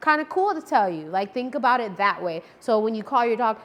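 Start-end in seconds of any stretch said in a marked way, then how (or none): 0.0-1.1 higher, brighter, like greeting a buddy; friendly, like to a friend. Shown as a demonstration. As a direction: tell your friend something cool. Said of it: "say it like they're your friend".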